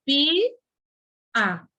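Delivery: "pi, a" as two separate beats with a pause; split into syllables. Shown as a demonstration. Teacher splitting pia for the students, separate beats. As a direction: break "pia" into pi and a. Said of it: "essa palavra se separa".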